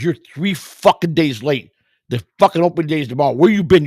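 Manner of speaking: loud, high retold scolding; angry manager. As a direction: imitate a boss chewing out a latecomer. Manager scolds late player, retold.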